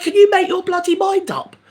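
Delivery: exasperated outburst. Retold or imitated. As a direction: throw up your hands at the signs. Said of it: "I was like".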